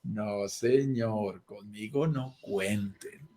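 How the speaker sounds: No, señor, conmigo no cuenten, despacio y muy bajo; negativa lenta y baja. Pretend. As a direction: cierra la puerta con calma firme. La vocecita rechaza prestar. (rechazo lento y apagado)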